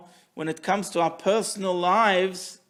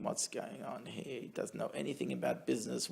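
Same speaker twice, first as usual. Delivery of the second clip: voiceless whisper, inner worry; whispered thoughts; 4 semitones lower, 15 dB quieter than usual. Acted. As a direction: hush it like a private doubt. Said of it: "He's thinking".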